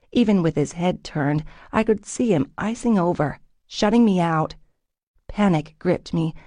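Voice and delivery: ordinary delivery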